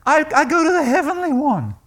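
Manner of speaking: bold answer. Acted. Answering the churchgoer.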